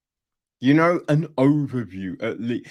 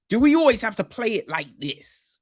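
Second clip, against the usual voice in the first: exasperated; 8 semitones higher than usual. Real, not acted. Critic fed up with film cliché.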